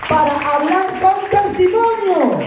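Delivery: very high, drawn-out call; ringing, stretched-out preacher's call; high proclamation. Real, not acted. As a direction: proclaim it high and slow to the congregation. Preacher proclaims testimony to congregation.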